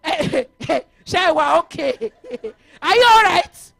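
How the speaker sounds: high, loud shocked exclamation with a laugh; loud astonished "are you okay" with a laugh; shocked disbelief. Retold or imitated. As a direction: act stunned someone could say that. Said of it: "And I said".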